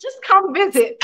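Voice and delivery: high, exasperated motherly scolding; teasing mom. Retold or imitated. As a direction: tease her in a high scolding tone. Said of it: "I'm like".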